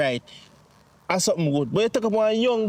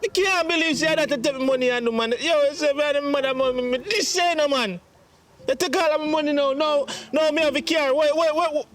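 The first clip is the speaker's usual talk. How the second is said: high, agitated pleading; worked-up son, voice pitched high; distraught protest; 5 semitones higher than usual. Acted. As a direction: protest in disbelief, pitch climbing. Son distraught over taken money.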